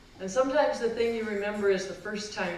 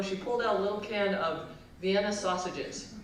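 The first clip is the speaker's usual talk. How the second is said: usual speaking voice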